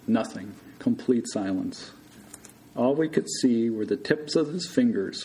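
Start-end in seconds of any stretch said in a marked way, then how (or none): none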